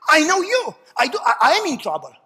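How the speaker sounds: loud, high declaration of faith; shouted prayer. Shown as a demonstration. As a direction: shout it up to heaven.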